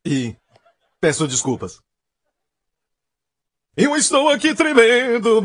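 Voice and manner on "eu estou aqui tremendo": high, hammed-up quaking voice; mock fearful trembling. Preacher reprising his joke about trembling, high mock-fearful wail. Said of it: "não quer dizer que ele estava assim".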